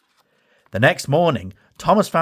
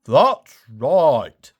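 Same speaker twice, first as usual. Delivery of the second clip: Ferdinand voice; 4 dB louder than usual. Acted. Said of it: "added Ferdinand".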